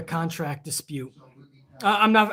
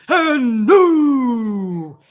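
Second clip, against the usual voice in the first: announcer voice; 6 semitones higher, 8 dB louder than usual. Acted. Fond mock announcer tribute.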